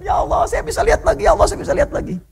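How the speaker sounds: high, ecstatic cry of thanks; overjoyed cry. Retold or imitated. Egyptian man crying to God after seeing again, high and overjoyed. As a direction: let the joy break out high. Healed man cries out in joy.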